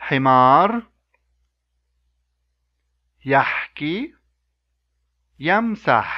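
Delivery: "Himar, yahki, yamsah" each clear with a pause for echo; model words for repeating. Shown as a demonstration. Teacher leading a repeat-after-me drill. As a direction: leave space for the class to repeat. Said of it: "now repeat after me".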